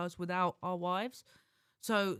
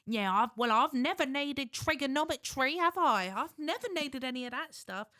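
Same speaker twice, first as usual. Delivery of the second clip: higher, louder impression of a counter lady; woman behind the counter; 5 semitones higher, 4 dB louder than usual. Comic retelling of an overheard post office worker, high-pitched counter lady. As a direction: put on a high, emphatic shop-worker voice. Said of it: "I heard the woman behind the counter go".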